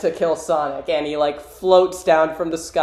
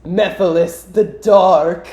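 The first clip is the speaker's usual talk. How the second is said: lightly voiced creepy villain; a hint of hammy, drawn-out villainy; Mephiles; 4 dB louder, slower than usual. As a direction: introduce yourself with theatrical menace. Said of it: "the creepy guy".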